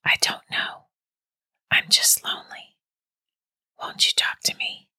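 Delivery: lonely, pleading, all breath; voiceless, needy hush; eerie whisper. A narrator's reading. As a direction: whisper it like a phantom on the line. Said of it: "still in a whisper".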